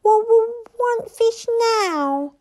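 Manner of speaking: high, stuttering, drawn-out demand; hissing little dragon. A narrator's reading. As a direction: whine for food as a tiny dragon. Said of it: "hissed Toothless".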